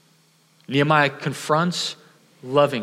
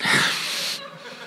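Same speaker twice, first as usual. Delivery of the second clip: airless, unvoiced burst of laughter; a soundless, breathy laugh at the memory; 4 dB quieter than usual. Real, not acted. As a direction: laugh silently at your own mess. Laughing at the hanging car.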